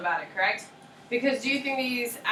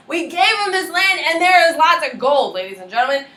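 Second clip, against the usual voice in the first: higher, louder, hammed-up outrage; mock indignant British; 8 semitones higher, 8 dB louder than usual. Teacher voicing the British complaining about the gold. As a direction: overplay the greedy grievance. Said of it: "So the British are like".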